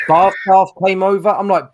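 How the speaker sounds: usual speaking voice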